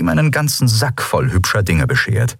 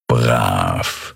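low purring cat lord; 10 semitones lower than usual. Acted. The old tomcat Chasu praises servant.

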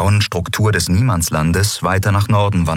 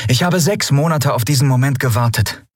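higher-voiced earnest statement; lightly voiced captain, serious; 9 semitones higher than usual. A narrator's reading. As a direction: speak seriously to your crew in a raised voice. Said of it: "sagte Endriel ernst".

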